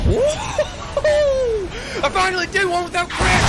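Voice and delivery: high, laughing whoop of victory; triumphant cheer. Real, not acted. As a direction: cheer like you finally nailed it. Cheering after a finished run.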